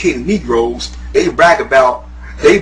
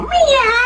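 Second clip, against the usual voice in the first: high mocking meow call; mock frat meow. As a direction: mimic a frat's meow chant, way up high.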